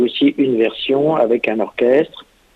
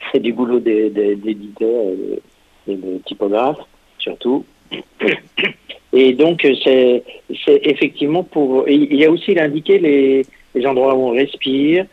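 ordinary talk

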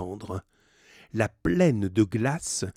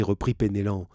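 ordinary delivery, nothing marked

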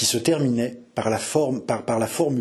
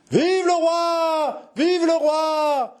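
high, long-drawn acclamations; furious cheers; much slower than usual. Acted. Courtyard crowd acclaiming king and constable, joyful cries. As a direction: cheer like a crowd, holding each last vowel. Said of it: "les cris de joie éclataient furieusement".

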